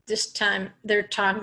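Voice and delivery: ordinary talk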